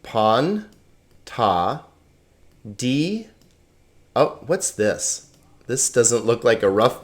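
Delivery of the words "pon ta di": "Pon, ta, di" in separate, spaced syllables. Shown as a demonstration. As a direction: sound out "Pon, ta, di" with gaps between. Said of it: "on to the pronunciation".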